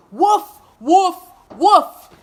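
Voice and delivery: loud high woofs; storyteller barks loudly as the dog; barking dog. Acted. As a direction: bark it out big, like a dog.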